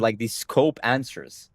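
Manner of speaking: ordinary talk, nothing marked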